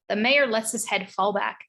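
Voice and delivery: usual speaking voice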